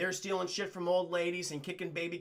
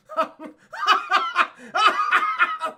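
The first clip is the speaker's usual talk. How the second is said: loud, high cackling laugh; just lose it laughing; long howling laugh that breaks the sentence; real burst of laughter; 11 semitones higher, 10 dB louder than usual. Real, not acted. Streamer cracks up mid-recap.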